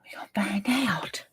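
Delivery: whispered anticipation. Real, not acted. Whispered waiting for result.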